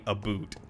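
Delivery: "a boot", oo instead of ow; softly dropped Canadian-style "a boot"; mocked Canadian about. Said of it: "picked on for saying".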